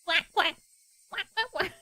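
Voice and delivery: a high, wrong-sounding duck quack. Pretend. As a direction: fumble a duck call up high. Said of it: "Can't remember what they sound like".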